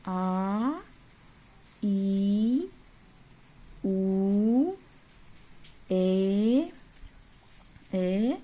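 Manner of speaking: each long vowel आ, ई, उ, ए stretched out; long vowels stretched for the class; drawn-out vowels. Shown as a demonstration. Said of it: "इसको थोड़ा सा हम लोग को खींच के बोलना पड़ता है".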